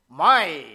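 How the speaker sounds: "my" alone, loud and dropped low; stress on my. Shown as a demonstration. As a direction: isolate "my" and drop it heavily. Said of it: "that is the emphasis".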